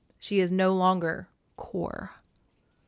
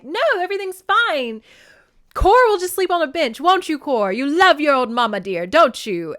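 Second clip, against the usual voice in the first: higher and louder, manic sing-song mom; unhinged mother; 7 semitones higher, 8 dB louder than usual. Retold or imitated. Demeter losing her mind over her daughter, manic. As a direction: smother her with forced cheer. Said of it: "she's just like".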